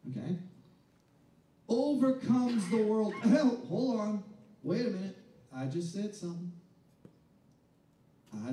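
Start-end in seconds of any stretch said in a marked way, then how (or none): 1.7-4.2 slow, loud stressed phrase; loud, slow preacher emphasis; emphatic proclamation. Real, not acted. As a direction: drive each word home slowly.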